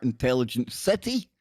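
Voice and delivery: ordinary talk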